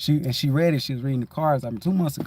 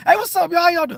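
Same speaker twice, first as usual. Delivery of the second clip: much higher, loud cheerful hello; hearty greeting; 5 dB louder than usual. Retold or imitated. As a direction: call out warmly to everyone. Acts out greeting people.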